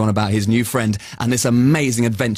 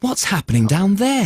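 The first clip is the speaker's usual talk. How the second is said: wondering aloud; 6 semitones higher than usual. Acted. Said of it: "he thought to himself".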